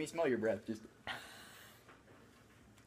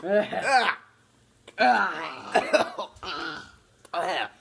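disgusted cries; 7 semitones higher, 5 dB louder, much slower than usual. Real, not acted. Reacting to gross jelly bean.

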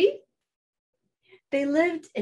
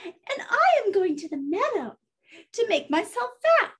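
tiny high-pitched little goat; squeaky-small storybook goat voice; 9 semitones higher than usual. Acted. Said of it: "in his very small voice".

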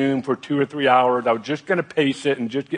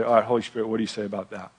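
softer, a quiet inward prayer to the Spirit; hushed prayer; 4 semitones lower, 6 dB quieter, much faster than usual. Retold or imitated. Pulling over to quietly pray, hushed prayer. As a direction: ask it gently under your breath.

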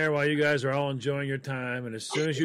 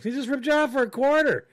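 higher and louder, incredulous; indignant outburst; 12 semitones higher, 5 dB louder than usual. Retold or imitated. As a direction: blurt it out higher and louder, fed up. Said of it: "You know me. I'm like".

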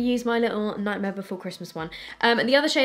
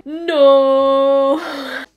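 long, loud drawn-out "no"; dismayed wail; 3 semitones higher, 13 dB louder than usual. Real, not acted. Vlogger sees her powder has gone dry, dismayed wail. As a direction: wail it out loudly and hold it.

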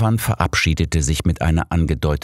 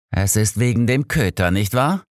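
annoyed; 7 semitones higher than usual. A narrator's reading. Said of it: "erwiderte Holmes genervt".

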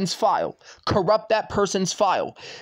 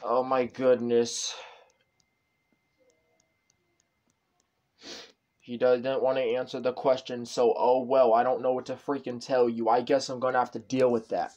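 defeated mumble; 6 semitones lower, 8 dB quieter than usual. Real, not acted. Resigned after fight with dad.

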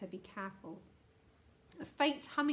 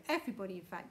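usual speaking voice

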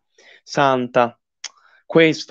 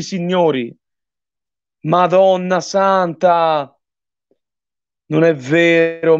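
usual speaking voice

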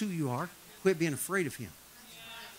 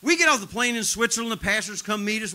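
usual speaking voice

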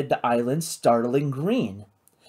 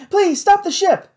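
high, loud urgent plea; 6 dB louder than usual. A narrator's reading. Kate begs the crew to halt, high urgent plea. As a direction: plead urgently, voice pitched way up.

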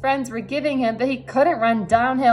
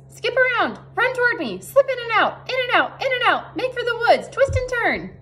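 very high-pitched goose voice; goose giving orders; 14 semitones higher than usual. A narrator's reading. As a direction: command them as a high, squawking goose. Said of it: "began to give orders".

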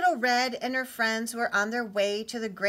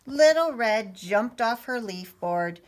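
ordinary delivery

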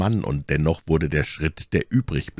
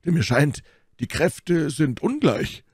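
Porthos; 11 semitones higher than usual. A narrator's reading. Porthos warns that they are outnumbered, calling out. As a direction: keep the pitch up and stretch the words. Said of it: "rief Porthos".

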